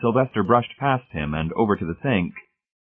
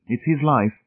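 higher, reproachful wife; mild, higher-pitched reproach; chastising; 7 semitones higher than usual. A narrator's reading. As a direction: chastise him with pitch raised. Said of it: "she chastised her husband".

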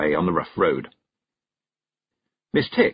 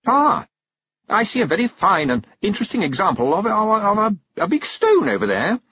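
high, loud, syrupy pretence; sticky-sweet voice; 12 semitones higher, 4 dB louder than usual. A narrator's reading. Witch making an excuse to leave, sticky-sweet. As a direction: coo it with sugary, halting tact. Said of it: "said Miss Tick in a sticky, sweet voice".

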